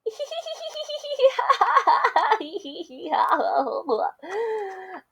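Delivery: over-the-top storybook laugh; big hearty laugh. Acted. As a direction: laugh it up at the joke. Snake laughs at the joke.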